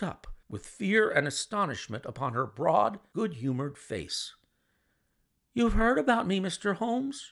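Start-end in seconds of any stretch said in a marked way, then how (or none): 5.5-7.3 higher, louder startled question; startled cry. A narrator's reading. Mary astonished Holmes knows her, in a high startled cry. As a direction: blurt it out, caught off guard. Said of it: "she cried".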